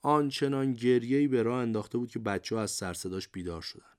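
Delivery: ordinary delivery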